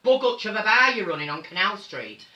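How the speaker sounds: big high loud camp "oh hello darling", teasing a friend caught watching; loud, high, teasing scold; loud mock-scolding greeting. Real, not acted.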